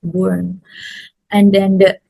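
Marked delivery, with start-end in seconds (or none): 0.0-0.6 burn with an oo vowel; mispronounced. Retold or imitated. Teacher rejecting the student's burn, mispronounced. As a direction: say burn as BOORN. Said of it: "not burn".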